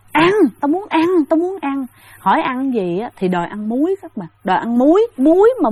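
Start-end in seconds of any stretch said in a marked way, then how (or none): 0.1-1.9 a hungry spirit insisting on food; demanding ghost. Retold or imitated. Ghost in the corpse demanding food, high and insistent. 4.8-5.7 high, loud burst on muối; excited emphasis. Real, not acted. Storyteller to listeners, amazed the ghost wants salt.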